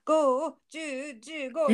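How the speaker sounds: singsong mock countdown; hide-and-seek counting. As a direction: count aloud like the seeker in a game. Major counting for hide-and-seek, retold.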